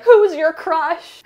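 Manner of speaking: high, loud and slow, squealing; giddy gushing. Retold or imitated. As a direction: swoon it out like a fangirl. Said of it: "he's literally like".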